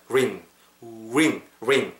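short modelled "ring" with a full R; rounded R. Shown as a demonstration. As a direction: RING released from an "oo" lip shape. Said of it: "이 상태에서 ring 뱉으세요".

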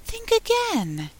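high, gentle little fawn voice; soft, sweet fawn. A narrator's reading. Fawn asking Alice her name, soft sweet high voice. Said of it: "Such a soft, sweet voice it had".

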